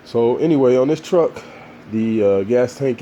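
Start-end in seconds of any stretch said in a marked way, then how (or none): none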